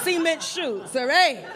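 swagger it like a Jersey mob cousin; lightly done Jersey accent; New Jersey accent. Acted. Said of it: "I'm Miss New Jersey".

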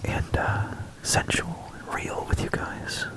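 hushed sultry whisper; ASMR whisper. Pretend. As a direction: breathe the words softly. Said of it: "a little A S M R".

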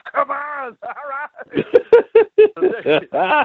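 high, loud laugh breaking through the words. Retold or imitated. Teller imagining letting Arnold hear the CD, laughing high. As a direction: crack up mid-phrase.

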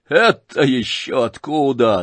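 plain reading voice

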